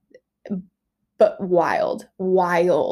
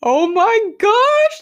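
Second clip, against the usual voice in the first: slow, high, loud gasp of awe; awestruck; 12 semitones higher, 8 dB louder, much slower than usual. Retold or imitated. Mother seeing her newborn pulled out, slow loud awestruck gasp. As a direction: draw it out in awe, much higher and louder. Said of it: "And I'm like".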